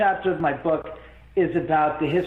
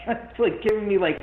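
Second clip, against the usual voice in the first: ordinary talk, nothing marked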